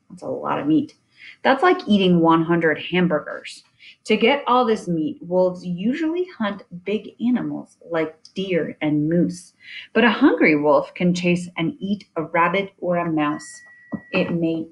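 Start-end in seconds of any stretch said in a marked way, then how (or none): none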